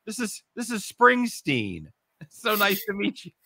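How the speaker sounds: high, slow, gushing voice; acted proud introduction. Father introduces his kid at the concert, high and gushing. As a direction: present the kid with high-pitched delight.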